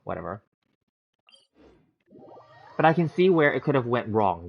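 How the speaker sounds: ordinary delivery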